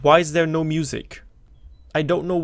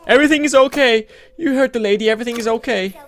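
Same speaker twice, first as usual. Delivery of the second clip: mock soothing; 7 semitones higher, 8 dB louder, faster than usual. Reassuring the robot mockingly.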